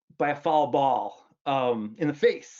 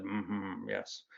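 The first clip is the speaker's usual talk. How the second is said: low, soft, resigned agreement; imitating dad's low, quiet yes; dad's deep voice; 6 semitones lower, 11 dB quieter than usual. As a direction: sink into the father's deep murmur. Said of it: "my dad had this very deep voice".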